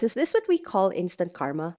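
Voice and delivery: ordinary talk, nothing marked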